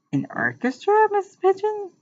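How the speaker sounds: disbelieving, pitch soaring; astonished question. A narrator's reading. Teacher asks the late pupil in amazement, voice shooting up. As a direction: voice leaping high on the question. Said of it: "asked Missus Pigeon".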